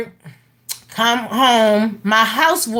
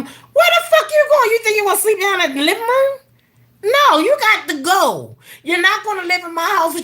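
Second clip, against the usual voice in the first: much higher, laughing-mad telling off; heated scolding; 8 semitones higher than usual. Retold or imitated. Mother telling son's girlfriend to leave, heated and higher. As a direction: order her out, exasperated.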